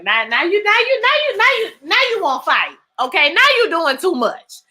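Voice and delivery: high, stammering disbelief; sputtering it out, incredulous; talking back to the TV, high and worked up; exasperated call-out. Retold or imitated. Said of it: "I said".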